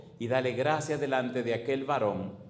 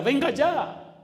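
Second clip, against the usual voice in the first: venga ya cried out high, stress on venga; urgent high plea; high pleading call; 12 semitones higher, 5 dB louder, much slower than usual. Shown as a demonstration. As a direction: plead loudly, lifting venga. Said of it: "Pero venga ya".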